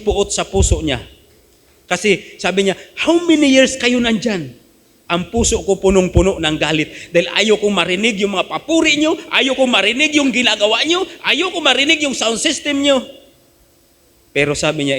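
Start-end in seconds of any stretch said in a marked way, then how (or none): 3.0-13.1 heated confession. Retold or imitated. Neighbor confessing his anger.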